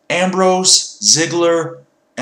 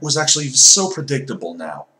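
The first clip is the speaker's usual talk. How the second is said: ordinary delivery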